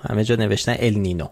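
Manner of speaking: ordinary delivery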